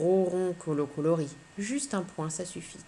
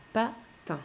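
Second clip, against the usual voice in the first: "Patin" higher, in two beats, Pa, pause, tin; split into syllables; 4 semitones higher than usual. Shown as a demonstration. Teacher confirms the count for pupil, "Patin" split in two syllables. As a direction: separate "Patin" after Pa. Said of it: "J'entends deux syllabes".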